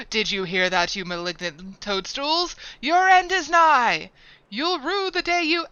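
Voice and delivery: sneering panto villain, loud; wrathful rant. Acted. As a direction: gloat and threaten like a stage villain. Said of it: "The wrath was upon me".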